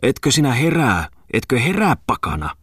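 high-pitched, heated wake-up demand; heated scolding. A narrator's reading. Miller's wife rousing a sleeper from downstairs, heated scolding. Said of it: "myllärin emännän kiivaan äänen".

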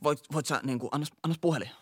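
trembling guy. Retold or imitated. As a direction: stutter like a jittery junkie. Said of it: "vapiseva kaveri".

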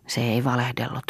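usual speaking voice

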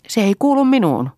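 high, loud, heated dismissal; angry refusal. A narrator's reading. Mother refusing responsibility for the family, angrily.

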